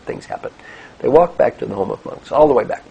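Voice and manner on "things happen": dismissive monk. Acted. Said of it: "The wet monk says".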